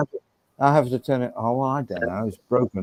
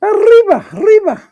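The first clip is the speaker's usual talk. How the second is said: high, loud hammed-up Mexican cry; mock Mexican accent; 8 dB louder than usual. Host answers a viewer's favourite, shouted mock Mexican accent. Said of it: "My favourite is the Mexican accent".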